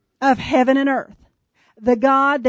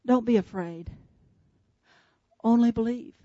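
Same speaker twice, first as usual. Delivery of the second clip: soft, low and calm reassurance; 3 semitones lower, 7 dB quieter than usual. Retold or imitated. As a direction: gently reassure a frightened father. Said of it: "in a calm voice".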